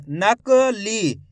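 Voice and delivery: नकली drawn out syllable by syllable, very slow; slow syllable demo. Shown as a demonstration. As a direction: stretch नकली so each sound is heard. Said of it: "ली बोलेंगे तो". ("नकली" को बहुत धीरे, अक्षर-अक्षर बोलें)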